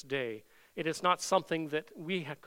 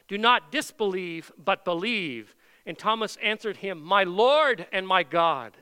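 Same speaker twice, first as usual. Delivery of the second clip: high, louder preaching of the gospel; fervent preaching; 4 semitones higher, 6 dB louder than usual. Acted. Pastor preaching Jesus appearing to Thomas, loud and fervent. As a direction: ring out the story to the pews.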